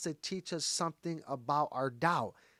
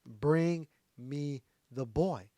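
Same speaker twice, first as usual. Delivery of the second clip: very slow, word-by-word order; slow command; much slower than usual. Acted. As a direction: draw out each word of the command. Slow retelling of Jesus's command.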